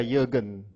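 Jürgen with a y sound at the start; German pronunciation. Shown as a demonstration. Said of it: "That's a German way of pronouncing it".

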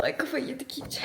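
soft breathless whisper; tired whisper after the fun; whispered aside. Real, not acted. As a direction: mutter it under your breath. Aside after mock fight.

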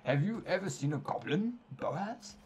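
hammed-up scorn for goblins; sing-song character voice, swooping up; game character reading out quest lines.

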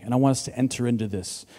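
usual speaking voice

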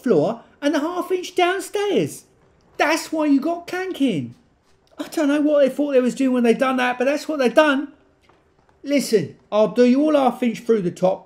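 loud, high cockney builder pitching a job; brash East London plumber talking up his quote; East London tradesman. Acted.

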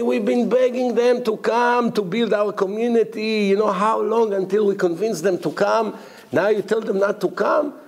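high-pitched acted remonstrance; exasperated reproach. President reproaching the lecturer about the community, exasperated.

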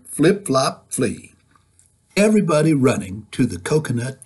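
put-on lively, sing-song read-aloud for kids; reading to children. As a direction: read it bouncy, like storytime. Reading picture book aloud.

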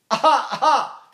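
high, slow "ah" cries; baby cry. Shown as a demonstration. Teacher shows how he sings, high baby cries. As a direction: let out baby wails before singing. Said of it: "how do I sing?".

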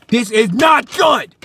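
slow, emphatic outcry; panicked shout. Acted. Man panicking over stalker's visit, shouting. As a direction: punch out each word in alarm.

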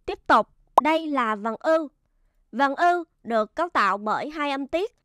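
normal talking voice

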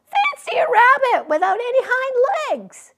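high, loud mocking jeer; mocking rabbit. A narrator's reading. Wild rabbit mocking the little rabbit, high and jeering. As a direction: jeer at him before laughing. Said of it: "And he began to laugh".

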